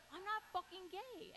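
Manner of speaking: high indignant protest. Retold or imitated. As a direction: protest it, pitch rising. Insisting to sister.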